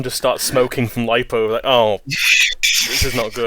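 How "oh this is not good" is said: robot arm voice. Pretend. Robot arm voiced while smoking.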